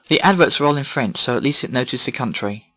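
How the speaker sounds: ordinary talk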